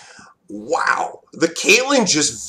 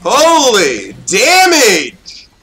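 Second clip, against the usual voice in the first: excited exclaim; 5 semitones higher, 4 dB louder, much slower than usual. Real, not acted. Hyped gamer exclaiming big damage.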